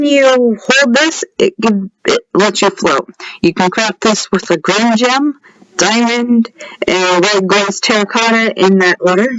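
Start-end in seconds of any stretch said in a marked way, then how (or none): none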